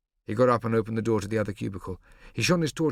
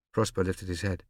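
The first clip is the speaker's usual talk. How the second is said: usual speaking voice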